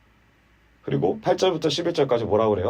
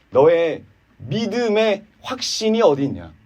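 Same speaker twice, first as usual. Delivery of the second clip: ordinary talk, nothing marked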